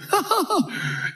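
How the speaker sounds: put-on high giddy childlike giggle; giddy laugh. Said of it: "like they're giddy children".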